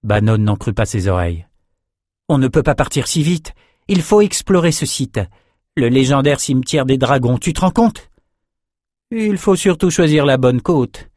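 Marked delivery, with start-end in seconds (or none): 2.3-8.1 put-on high, excited young companion; Bannon, eager and incredulous. A narrator's reading. Disciple begging to explore dragon cemetery, high and amazed. 9.1-11.1 firm higher-pitched caution; tempering, higher voice. A narrator's reading. Sorceress moderating her companions, higher and firm. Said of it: "modéra Nietzsche".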